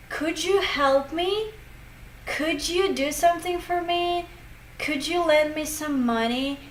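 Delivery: every "Could you" said as "couldju"; lifted example questions, "Could you" blended with a J; linked J sound. Shown as a demonstration. Teacher listing examples for learners, "Could you" as "couldju". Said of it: "You can also hear a sound J there".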